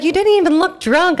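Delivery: high loud exasperated yell. Retold or imitated. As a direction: yell it in disbelief. Cab driver yelling at her.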